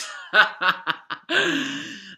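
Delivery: loud, high chuckling burst; high cackle; amused laugh. Real, not acted. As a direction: crack up at a cheeky gag. Amused by the skirt gag.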